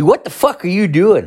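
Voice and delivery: high-pitched mock scolding; high, exasperated wife impression; imitating outraged wife. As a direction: act out a wife's incredulous demand. Retold angry wife confronting him.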